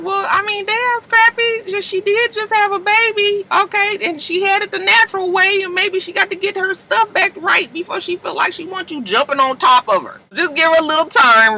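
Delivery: put-on high voice for the girls giving advice; friends talking to Scrappy. Scrappy's friends telling him to give her time, high voice. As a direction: pitch way up, teasing but patient. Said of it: "they was just like".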